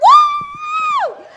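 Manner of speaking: very high, loud, long whoop; ecstatic whoop. Retold or imitated. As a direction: let out a shrieking church whoop. Whoops over handsome man.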